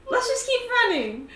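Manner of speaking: high, loud urging. Acted. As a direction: push the runner onward. Urging a party member upstairs.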